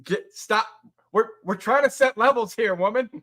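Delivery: high, loud playful protest; loud, high mock indignation; mock exasperated scolding. Mock scolding partner over mic levels.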